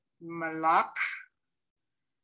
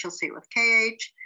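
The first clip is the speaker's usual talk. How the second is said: ordinary delivery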